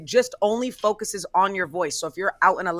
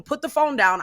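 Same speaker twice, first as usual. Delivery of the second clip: normal talking voice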